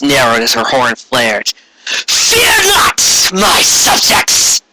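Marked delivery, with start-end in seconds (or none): 1.9-4.6 much higher, slow, regal villain voice; Corona proclaiming. A narrator's reading. Tyrant queen to conquered ponies, high regal proclamation. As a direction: address the crowd like a gloating tyrant queen. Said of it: "the tyrant's son said".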